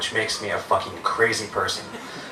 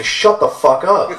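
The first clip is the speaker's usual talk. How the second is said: slow, raised-pitch mock yell; loud retort; 8 semitones higher, 5 dB louder, slower than usual. Protective mom to the bum bothering her son, loud telling-off. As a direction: act out loudly telling someone off, drawn out. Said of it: "To which I loudly say".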